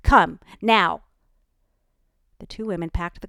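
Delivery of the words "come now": much louder harsh command; harsh shout. A narrator's reading. Ellie ordering the kids home, harsh shout. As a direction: bark it so they obey. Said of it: "her voice the harshest tone".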